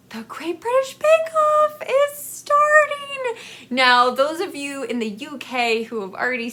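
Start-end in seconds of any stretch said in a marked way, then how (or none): 0.1-3.4 high, slow, mock-excited announcement; imitating Jason. Husband announcing the Bake Off is starting, high mock-excited voice. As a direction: sing it out like a thrilled husband. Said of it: "he's like".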